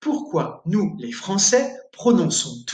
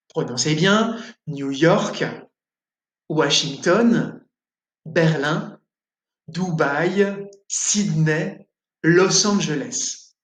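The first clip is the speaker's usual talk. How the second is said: "New York", "Washington", "Berlin", "Dubaï", "Sydney", "Los Angeles" each stressed on the last syllable, French style; weight on the end of each city name; stress on final syllable; 2 semitones lower, slower than usual. Shown as a demonstration. Teacher showing French final-syllable stress on city names. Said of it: "il faut insister à la fin du groupe de mots".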